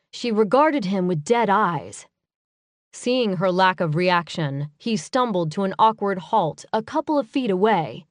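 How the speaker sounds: plain reading voice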